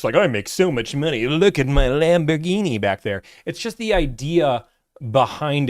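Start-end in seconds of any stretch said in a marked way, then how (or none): 0.1-3.2 a hint of a show-off; lightly hammed-up flex; mock bragging. Mocking a flashy salesman to viewers, mild mock brag. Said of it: "this isn't meant to be a flex".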